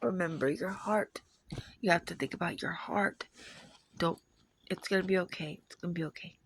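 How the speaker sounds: soft, hushed comforting partner; imitated reassurance. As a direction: retell it softly, soothing someone upset. Retold partner reassuring her.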